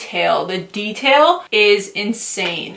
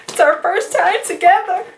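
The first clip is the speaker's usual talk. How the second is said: high, cutesy playful voice; playful cooing; 9 semitones higher than usual. Pretend. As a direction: coo it high, like to a sweetheart. Playfully addressing the new palette.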